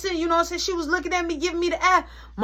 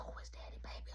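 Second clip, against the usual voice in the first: hushed unvoiced who is Daddy Baby; whispered question; 24 dB quieter, faster than usual. Asking Mark under breath, whispered. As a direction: whisper it to your friend.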